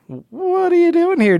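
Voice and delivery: high, squeaky-pitched startled question; comic high-pitched surprise; startled mock question. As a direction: ask it high, as if caught off guard. Voicing startled Dreiberg finding intruder.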